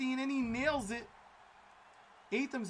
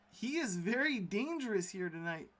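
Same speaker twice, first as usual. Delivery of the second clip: usual speaking voice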